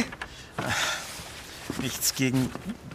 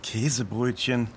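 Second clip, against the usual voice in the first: laughing; 5 semitones lower than usual. Shown as a demonstration. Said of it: "Klingt aber schöner".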